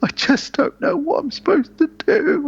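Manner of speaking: high, far-off mock sob; imitating crying George. A father sobbing about his undead son, high mock cry. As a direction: squeeze it out high like a weeping dad. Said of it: "he says, ... starts crying".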